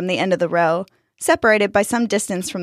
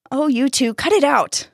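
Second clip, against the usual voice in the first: higher-pitched telling-off; exasperated scolding; 7 semitones higher than usual. A narrator's reading. Gardener to two squabbling heads, exasperated scolding. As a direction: break up the bickering, voice lifted.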